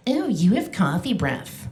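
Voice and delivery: a hint of a small child, blunt and slow; little kid voice. Kid on her lap telling the comic her breath smells, light childish voice. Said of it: "he was like".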